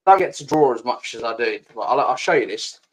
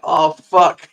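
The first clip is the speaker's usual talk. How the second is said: a hammed-up "oh, fuck" of sudden need; acting out the dread, higher and louder; jokey groan of realisation; mock dismay; 5 semitones higher, 9 dB louder than usual. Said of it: "And I'm just sat here like".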